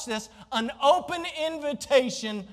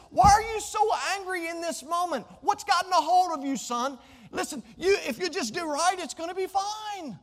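higher voice, urging a wayward son; higher God voice begging Cain to do right; God pleading with Cain; 9 semitones higher than usual. Acted. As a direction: coax him like a worried father.

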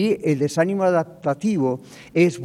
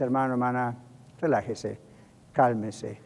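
soft, low reassurance with pauses; calming; 5 semitones lower, 8 dB quieter than usual. Acted. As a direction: gently settle someone who is worked up. Alguien viene a calmar al creyente. (tranquilizador, suave y grave)

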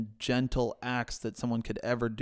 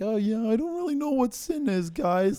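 high-pitched, comically clueless voice; clueless Jesus impression; 11 semitones higher, 4 dB louder than usual. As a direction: play it naive and high, as a joke. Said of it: "that was a great Jesus impression".

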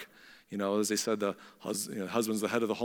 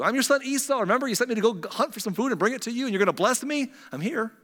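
high-pitched bluffing son; impersonating a brother; 9 semitones higher, faster than usual. Acted. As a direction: talk your way past Dad in a raised pitch. Jacob bluffs his blind father.

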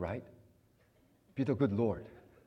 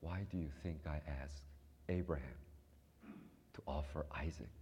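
low, soft, gentle divine question; quiet voice of God; 6 semitones lower, 10 dB quieter than usual. Retold or imitated. God answering the weeping preacher, soft and low. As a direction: ask it softly, as God would. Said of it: "And then Lord said".

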